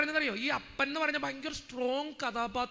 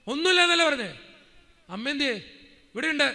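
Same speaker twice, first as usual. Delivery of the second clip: loud, brusque father, impatient and blunt; gruff father on the phone; 2 semitones higher, 8 dB louder than usual. Retold or imitated. Father impatient with his son on the phone, loud and gruff. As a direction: snap back at the son like an irritated old man.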